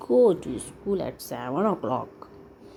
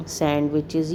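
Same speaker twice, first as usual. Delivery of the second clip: plain reading voice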